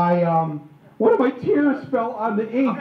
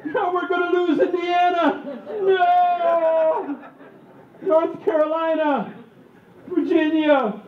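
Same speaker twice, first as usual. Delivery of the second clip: high, stretched-out mock horror; hammed-up despairing cry, vowels held long; slow-motion wailing; 9 semitones higher, much slower than usual. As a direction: wail each word as if in movie slow motion. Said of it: "you know, in slow motion".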